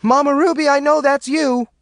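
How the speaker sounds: high, loud accusing shout. A narrator's reading. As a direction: call out the ghost, loud and high. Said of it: "Maureen shouted".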